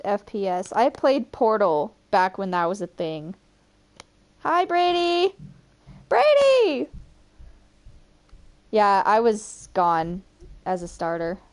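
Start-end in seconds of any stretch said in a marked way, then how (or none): 4.4-5.3 high-pitched greeting call; high, eager hailing voice; calling out hello. Real, not acted. 6.1-6.9 very high, loud call; high-pitched holler for attention; calling a name. Real, not acted.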